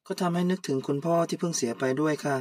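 usual speaking voice

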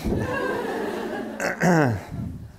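a high, bright burst of laughter at the story; real laugh. Real, not acted. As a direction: genuine amused laugh. Reacts to her own funny story.

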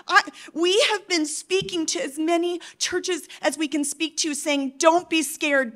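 rally the church to anger, hammer each phrase; preaching at full force. Acted.

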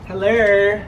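ordinary talk, nothing marked

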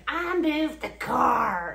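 loud, slow, mocking drawl; imitating Shanny's excuse, slow and loud; mocking whine. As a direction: drag it out, making fun of her. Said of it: "she's like".

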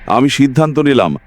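ordinary delivery

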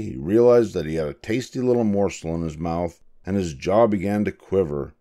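usual speaking voice

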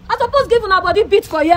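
furious at being filmed, threatening the crew; angry threat. Real, not acted. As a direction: threaten them hotly.

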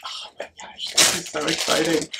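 hushed, voiceless thrill; excited whisper. Real, not acted. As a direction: whisper it in delighted awe. Awed opening of last gift.

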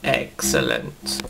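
low, slow villain growl; deep Davros voice. Acted. Davros gloats at the Doctor, low and slow. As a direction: savour it like a gloating mad scientist.

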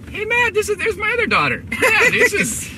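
very high, loud excited call; delighted calling out. Real, not acted. Dad spotting his other daughter, excited calling out. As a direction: call out in real delighted surprise.

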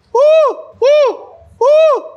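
high piercing "uh" hoots, very loud; loud hype hoots. Acted. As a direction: whoop like a hype man. (gritos de euforia)

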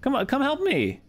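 high-pitched pleading call; calling for help. Real, not acted. Calling a player over for help.

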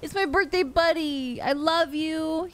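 high, slow, delighted gushing; fangirling as Rover appears on screen; thrilled squeal. Real, not acted.

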